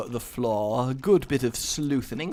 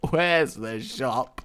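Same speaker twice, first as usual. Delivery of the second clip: ordinary delivery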